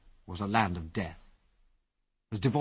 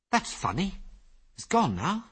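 puzzled; 8 semitones higher than usual. A narrator's reading. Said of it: "said Ginger in a puzzled voice".

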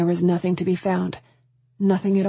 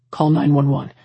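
louder, lower, firm command; urgent order; 2 semitones lower, 5 dB louder than usual. A narrator's reading. Tristan to Lizzie as child is missing, urgent order. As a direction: give a firm emergency order. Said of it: "he ordered".

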